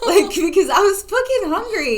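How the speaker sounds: high, loud comic wail; hammed-up distressed protest; mock meltdown. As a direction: wail it like a hungry tantrum. Acting out the hungry meltdown.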